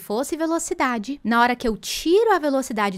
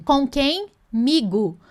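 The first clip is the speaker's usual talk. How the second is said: ordinary talk, nothing marked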